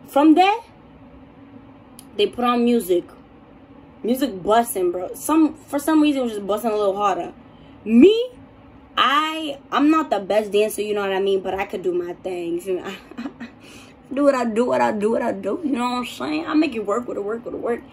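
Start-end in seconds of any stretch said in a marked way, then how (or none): none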